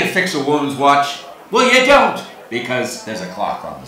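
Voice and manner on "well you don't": high, loud outburst; emphatic punchline. Shown as a demonstration. Comic answering the watch riddle, loud and exasperated. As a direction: shout it as the obvious answer.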